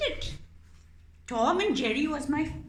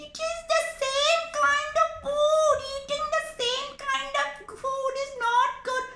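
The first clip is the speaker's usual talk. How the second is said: much higher, loud scolding squeak; squeaky lecturing mouse; 7 dB louder, faster than usual. Acted. Mouse puppet scolding the cat about its diet, squeaky.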